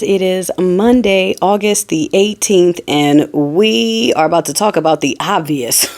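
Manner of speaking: loud, upbeat host intro; show opener. Acted. Vlogger greeting her viewers, loud upbeat host voice. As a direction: open the show big for the viewers.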